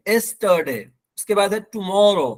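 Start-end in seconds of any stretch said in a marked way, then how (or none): 0.1-0.8 "एस्टरडे" starting with the vowel E; no initial Y. Shown as a demonstration. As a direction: say "एस्टरडे" without the Y. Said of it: "यस्टरडे wrong है". ("एस्टरडे" की शुरुआत ए से)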